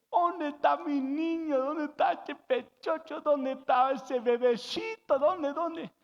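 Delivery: put-on high, loud, drawn-out baby talk; gushing mama voice, slow and high; happy doting mother. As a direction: coo over your baby boy in a high sing-song. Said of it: "llega la mamá muy contenta".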